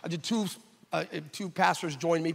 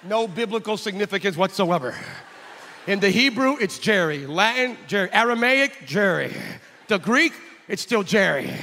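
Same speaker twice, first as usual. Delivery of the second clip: loud, emphatic comic declaration; deadpan joke proclaimed loudly; mock scholarly; 3 semitones higher, 7 dB louder than usual. Preacher joking to the crowd, loud mock scholarly declaration.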